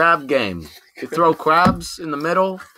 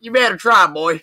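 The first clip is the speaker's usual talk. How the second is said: put-on Southern accent; 2 semitones higher, 4 dB louder than usual. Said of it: "That was Southern".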